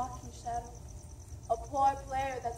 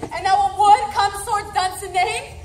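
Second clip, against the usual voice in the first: higher, loud incredulous shout; outraged shout; 8 semitones higher, 16 dB louder than usual. Acted. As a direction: rage at the impossible news. Macbeth's outraged disbelief.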